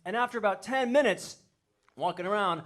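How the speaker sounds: usual speaking voice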